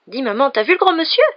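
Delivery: put-on high, loud child pressing his question; insistent little boy. A narrator's reading. Little boy insisting his mother answer him, high and loud. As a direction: pester mum like a four-year-old. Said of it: "insista Cory".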